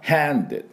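handed with a breathy h and wide a; English pronunciation. Shown as a demonstration. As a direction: huff the h in handed. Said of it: "はっはっはの音を入れながら".

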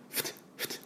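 breathy F puffs; F sound demo. Shown as a demonstration. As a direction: blow the F sound through the teeth. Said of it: "ダブルシーン".

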